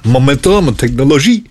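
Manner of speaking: mock French pronunciation of Technologie; cod French accent. Shown as a demonstration. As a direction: Technologie said the French way, stress on the last syllable. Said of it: "if I was gonna say it in a cod French accent".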